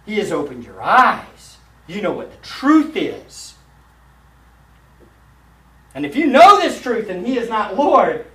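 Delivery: high, loud pulpit proclamation; fiery preaching. Acted. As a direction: drive the judgment home to the congregation. Preacher calls to salvation.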